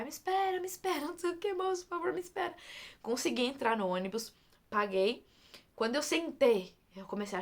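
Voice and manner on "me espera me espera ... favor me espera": high-pitched mock yell, no real volume; retold desperate cry. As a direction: plead in a high voice, as if calling after a bus. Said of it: "eu comecei a gritar". (grito imitado, agudo e suplicante)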